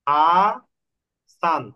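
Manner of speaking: হাসান split into আ and সান; teacher counting syllables. Shown as a demonstration. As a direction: break the name into two beats. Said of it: "splits হাসান into syllables".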